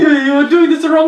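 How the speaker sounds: loud, high hammed-up French accent; exaggerated French accent at full volume. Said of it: "A French accent is just putting the accent".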